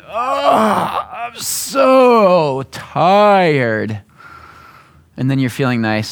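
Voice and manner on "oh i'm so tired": higher, drawn-out mock weary sigh; fishing for sympathy. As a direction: stretch out the tired act. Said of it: "Or someone walks in".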